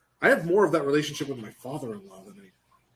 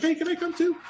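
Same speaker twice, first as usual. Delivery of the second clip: high, squeaky put-on voice; pleading tag-along. Parent begging to tag along with the kids, squeaky mock plea. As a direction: beg to join in, pitched way up.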